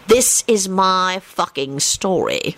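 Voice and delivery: slow, emphatic, restrained snarl; understated, drawn-out annoyance. A narrator's reading. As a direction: space out the words in irritation. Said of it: "Lupin snarled".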